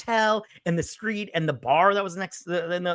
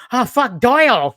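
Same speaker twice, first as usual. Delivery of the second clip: loud, high cry of dismay; panicked yell; 10 semitones higher, 9 dB louder than usual. Retold or imitated. Retelling the crash, Dutch yelling in panic. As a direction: yell out in shock as the car gets wrecked.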